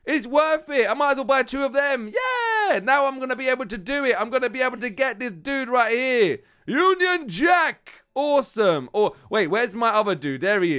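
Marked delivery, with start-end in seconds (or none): 2.1-2.8 high triumphant yell; high victory yeah; cheer. Real, not acted. Cheering purchase success. 6.7-8.0 slow, high, drawn-out name; slow grand Union Jack; proud announcement. Real, not acted. Announcing unlocked hero.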